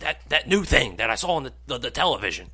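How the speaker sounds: lightly voiced high old man; a hint of doddering grandpa; dad sounding ninety. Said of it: "you sound like you're, like, ninety years old".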